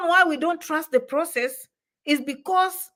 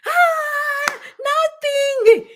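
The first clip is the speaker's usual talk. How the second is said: high, drawn-out held cry of dismissal; exaggerated high-pitched "nothing at all"; mock exclamation; 5 dB louder, much slower than usual. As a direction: sing it out high and long, dismissively. Mocking answer to own question.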